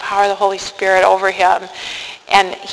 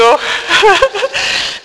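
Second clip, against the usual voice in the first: laugh; 12 semitones higher than usual. Real, not acted. Laughs after quoting her reply.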